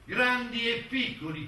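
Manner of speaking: ordinary talk, nothing marked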